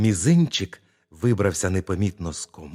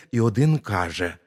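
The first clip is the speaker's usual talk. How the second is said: usual speaking voice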